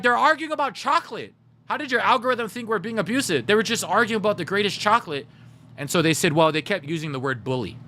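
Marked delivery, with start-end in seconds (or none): 0.0-5.3 high-pitched, retold indignation; incredulous protest. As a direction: protest in disbelief, acting out the complaint. Retold protest to Twitch support.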